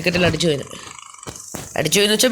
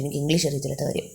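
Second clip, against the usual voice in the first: ordinary talk, nothing marked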